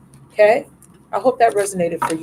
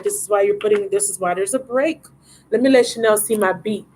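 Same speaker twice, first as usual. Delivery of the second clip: ordinary talk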